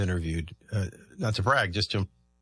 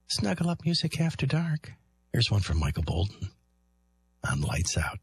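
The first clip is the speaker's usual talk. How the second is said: late-night love-songs DJ. Pretend. Host pretending to do an overnight love songs show, hushed DJ voice. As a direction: croon the links like an overnight DJ.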